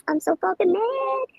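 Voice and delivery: ordinary talk, nothing marked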